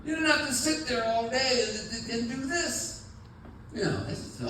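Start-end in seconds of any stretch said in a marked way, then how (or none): 0.0-3.0 exasperated, higher dad voice scolding; fussing at a kid over wasted money; mock-scolding parent, pitched higher. Speaker scolding a kid over jeans.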